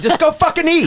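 very high, loud hammed-up yell; mock furious shout. Friend replays the shouting match, mock furious yell. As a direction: shout it like the casino blowup.

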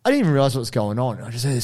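ordinary talk

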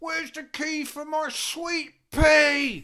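high, loud wailing shout; whiny wailing cry. Retold or imitated. Drummer wailing to the tour manager, high whiny cry. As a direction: wail his name like a spoilt child. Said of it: "Nico's going".

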